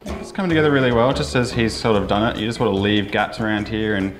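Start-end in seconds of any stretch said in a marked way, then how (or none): none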